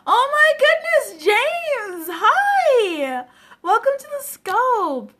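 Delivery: over-the-top gushing greeting. Retold or imitated. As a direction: greet him like a long-lost friend, hammed up. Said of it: "I'm just like".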